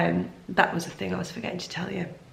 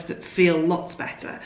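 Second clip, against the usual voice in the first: usual speaking voice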